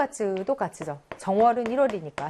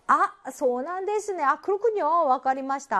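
mock realization; 6 semitones higher than usual. Teacher acting out a student's reply to an explanation, mock realization. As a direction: act out a listener suddenly catching on.

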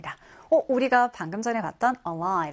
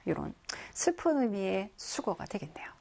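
whisper; 8 dB quieter than usual. Real, not acted. Sad meaning, dropped to whisper.

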